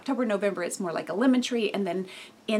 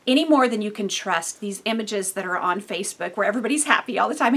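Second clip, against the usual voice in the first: normal talking voice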